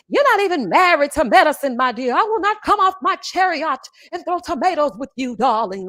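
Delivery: high, grand, theatrical put-on voice; mock her dramatic speech like a stage diva; theatrical Mariah impression. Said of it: "it was very theatrical every time she would speak".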